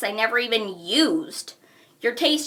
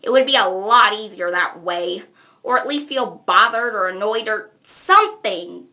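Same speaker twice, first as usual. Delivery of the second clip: normal talking voice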